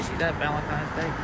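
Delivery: normal talking voice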